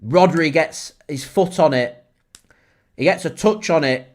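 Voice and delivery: normal talking voice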